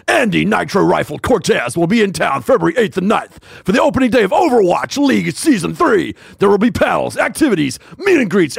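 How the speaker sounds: over-the-top esports announcer shout; epic esports announcer. Pretend. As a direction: sell the event like an arena hype man. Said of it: "Use an epic esports announcer's voice".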